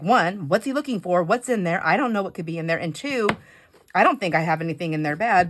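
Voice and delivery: usual speaking voice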